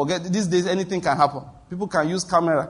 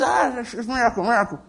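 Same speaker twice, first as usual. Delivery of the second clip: higher, awed crowd voice; mock amazed crowd; 6 semitones higher than usual. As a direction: gush like gullible believers. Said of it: "the people will say".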